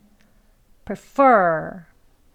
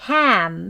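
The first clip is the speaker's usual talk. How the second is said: "ham" loud and held; strongest stress; 5 dB louder than usual. Shown as a demonstration. Teacher showing primary stress, "ham" loud and long. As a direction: punch "ham" as the main stress. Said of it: "ham을 가장 강하게".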